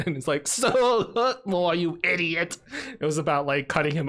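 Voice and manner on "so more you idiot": slow, higher-pitched exasperated villain; hammed-up sheriff scolding, words drawn out; Alan Rickman. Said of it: "that was an amazing Alan Rickman impression".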